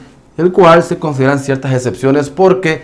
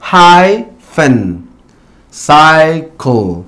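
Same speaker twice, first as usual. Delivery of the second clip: hyphen, cycle slowly split, HY-phen, CY-cle; stressed long I; much slower than usual. Shown as a demonstration. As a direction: stretch the "eye" in hyphen and cycle, pause before the last syllable. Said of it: "tiene un long I sound". (hyphen, cycle lentos, primera sílaba fuerte con "ai")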